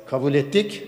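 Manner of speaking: ordinary delivery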